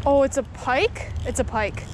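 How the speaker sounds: ordinary talk, nothing marked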